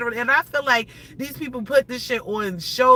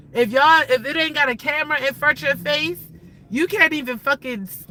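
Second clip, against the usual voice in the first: usual speaking voice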